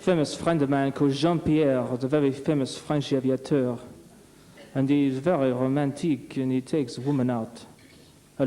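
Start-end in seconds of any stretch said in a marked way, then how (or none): 0.0-3.8 put-on overdreven Frans accent; French accent. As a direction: speel een Fransman met zwaar accent. Comedian slips into French accent.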